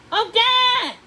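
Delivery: loud, high booming shout; villain calling out. A narrator's reading. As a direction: call her from far away. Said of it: "The voice was getting closer".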